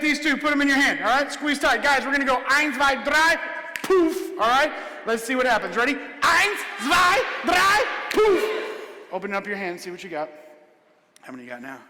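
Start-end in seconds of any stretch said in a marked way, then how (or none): 5.9-8.6 much higher, loud showman chant; shouted magic words. Acted. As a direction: belt the countdown like a stage magician.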